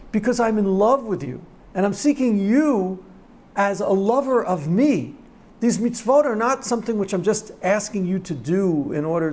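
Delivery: loud, soaring sermon delivery; impassioned preaching. Acted. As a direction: preach it with full fervour.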